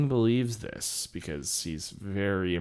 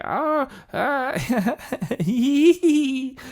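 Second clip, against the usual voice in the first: high-pitched howl breaking into giggles; giggly howl; 13 semitones higher, 4 dB louder, slower than usual. Retold or imitated. Ice King trying to howl for Finn, helplessly giggly. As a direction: start to howl, collapse into loud hee-hees. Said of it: "He starts laughing and says he's got the sillies".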